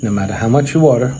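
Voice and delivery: loud, brisk commercial pitch; sponsor ad read. Acted. Host pitching a sponsor to his viewers, loud ad-read voice. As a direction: sell the wallpaper store to viewers, punchy.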